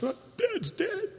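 put-on high, hushed young boy's shock; a child blurting out his father is dead; a boy's small, shocked voice; stunned boy.

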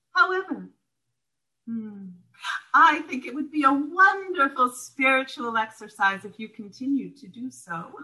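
hint of a teasing mentor nudging her on; sly sponsor. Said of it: "And she said".

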